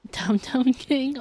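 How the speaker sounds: ordinary talk, nothing marked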